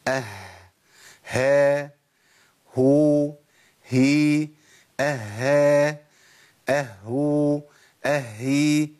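each from "اه" to "اهي" as a separate drawn-out syllable with clear h; ha states drill. Shown as a demonstration. Teacher running through all seven states, "اه" to "اهي" distinctly articulated. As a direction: recite "اه، ها، هو، هي" then the doubled forms, every h audible. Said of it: "نقولهم بقى ورا بعض".

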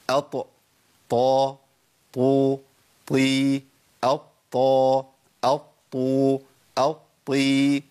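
steady recited drill from "أط" to "أط، طي", each ط heavy. Shown as a demonstration. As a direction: chant each syllable from "أط، طا" on with long level vowels. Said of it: "أقولهم بقى بشكل سريع كده". (ترديد حالات الطاء من "أط" لـ"طي" بتفخيم)

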